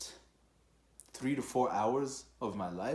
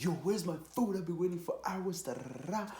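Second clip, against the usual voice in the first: yelling for food; 10 semitones higher than usual. Retold or imitated. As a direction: yell it at the kitchen staff. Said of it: "yelling at people".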